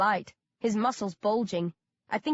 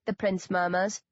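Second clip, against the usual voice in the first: ordinary delivery, nothing marked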